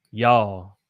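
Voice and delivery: y'all as one syllable, yawl; Southern y'all. Shown as a demonstration. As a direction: say y'all slow and long. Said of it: "Try to say it with me".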